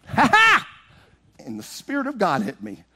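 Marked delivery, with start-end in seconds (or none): 0.1-0.7 high, loud "ha ha" burst; mocking laugh. Boy mocking the lady before the Spirit hits. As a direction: blurt it out like the lady. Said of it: "I went".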